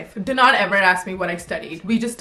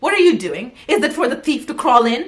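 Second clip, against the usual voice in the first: mock scolding; 4 semitones higher, 5 dB louder than usual. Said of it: "I'm like".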